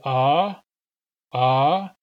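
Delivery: a loud, open ah that flexes the tongue root. Shown as a demonstration. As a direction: hold a loud, open ah and feel the throat. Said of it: "we often flex our tongue root".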